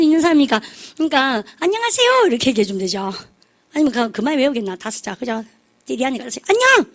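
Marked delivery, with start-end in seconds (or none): 1.6-2.3 high-pitched called-out greeting; imitated crowd reply. Audience answering singer in big stadium. 6.5-6.9 very high hailing shout-style hello; imitated call-out. Audience member calling to singer on stage.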